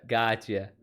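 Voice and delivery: ordinary talk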